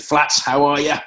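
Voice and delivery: mock Clive calling out a hearty hello; imitating Clive. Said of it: "he said".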